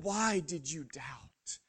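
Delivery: slow hushed whisper; whispered reproach. Acted. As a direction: breathe the words, barely voiced. Jesus voiced after rescue.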